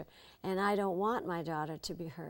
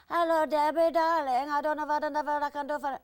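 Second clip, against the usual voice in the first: one tone higher, louder nervous Hungarian accent; Zsa Zsa impression; 9 semitones higher, 7 dB louder than usual. Shown as a demonstration. Debbie showing how Zsa Zsa differs from Ava, higher Hungarian. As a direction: coo a notch higher and more nervous. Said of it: "one tone higher".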